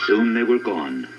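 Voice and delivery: plain reading voice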